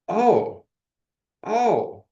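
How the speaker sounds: high, breathless oh of awe; awed gasp. Real, not acted. Telling a friend of a vision, awestruck high gasp. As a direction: let the wonder catch in the throat. Overwhelmed by spiritual moment.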